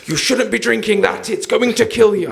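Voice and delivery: high, slow preachy nagging; mock scolding. Scolders warning a Monster drinker, mock scolding. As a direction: lecture in an exaggerated, drawn-out high voice. Mimicking people compelled to scold.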